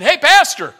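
high, loud shout to a pastor; calling out. Retold or imitated. Somebody hailing a pastor among many pastors, calling out. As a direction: call out across a crowd, loud and high. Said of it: "I remember somebody saying".

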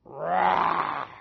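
half-hearted mock lion roar; loud but feeble lion roar; wimpy lion roar. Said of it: "That was kinda wimpy".

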